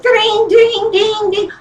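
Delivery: high sing-song ding ding like a ringing phone; phone ring imitation. Telling the crowd how the new phone rang, high mock ringtone. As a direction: mimic the phone ringing off the hook.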